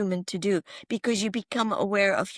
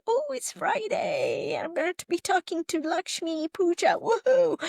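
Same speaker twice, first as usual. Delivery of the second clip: excited self-quote; 8 semitones higher than usual. Retold or imitated. Guest recalling her Friday morning thrill before the interview, high gleeful voice. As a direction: replay your giddy morning thoughts, high and bright.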